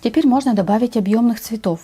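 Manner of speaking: normal talking voice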